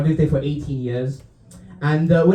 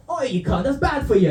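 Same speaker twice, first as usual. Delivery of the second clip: Australian dad; 8 semitones higher than usual. Acted. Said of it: "raised by their fun dad".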